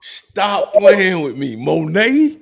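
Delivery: high, drawn-out excited outburst; loud disbelief. Real, not acted. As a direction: stretch it out loud in mock disbelief. Reacting in disbelief to named ex.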